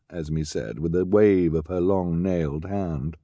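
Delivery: plain reading voice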